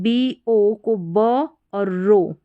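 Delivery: slow, split into ब and रो; syllable by syllable. Shown as a demonstration. Teacher breaks the word down slowly for students. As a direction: spell बरो out one part at a time. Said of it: "बरो करके इसको pronounce करेंगे".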